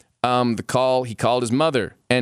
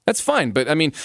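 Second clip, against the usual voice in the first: ordinary talk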